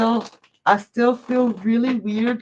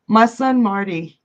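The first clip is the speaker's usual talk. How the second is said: normal talking voice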